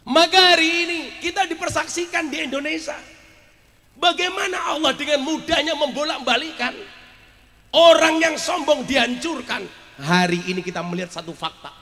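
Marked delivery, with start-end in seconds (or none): 0.1-9.7 high-pitched, ringing proclamation; impassioned preaching. Real, not acted. Preacher proclaiming how God overturns the proud, impassioned preaching. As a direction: declare it forcefully to the whole crowd.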